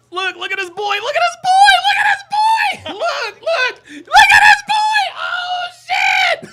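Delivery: high, loud shout breaking into laughter; excited shouting. Real, not acted. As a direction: yell in hysterical disbelief at what you see. Cracking up at video clip.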